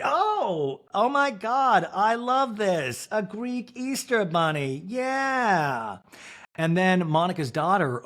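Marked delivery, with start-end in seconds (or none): 0.0-6.0 delighted. Real, not acted. Host delighted by the bunny.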